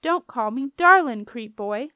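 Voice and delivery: urge to yell. A narrator's reading. Lucy wants to yell at the barge boy who calls her darling, loud irritated yell. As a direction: snap it loudly at a pest. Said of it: "fought down an urge to yell".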